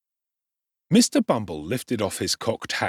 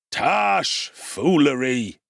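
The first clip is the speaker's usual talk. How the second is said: scornful beadle; 4 semitones higher, 3 dB louder, much slower than usual. Acted. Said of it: "interposed the beadle".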